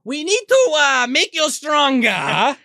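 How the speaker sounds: high, drawn-out put-on accent; hammy accent of no known country; unidentifiable mock accent. Scheming movie villain wants his test subject improved, unidentifiable mock accent. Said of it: "I don't know what this accent is".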